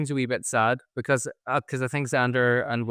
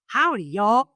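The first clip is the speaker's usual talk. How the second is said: higher, louder put-on American accent; 10 semitones higher, 7 dB louder, much slower than usual. Shown as a demonstration. Host shows off her AI American accent, higher and louder. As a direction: greet in a cartoon American accent. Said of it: "This is my A I voice from America".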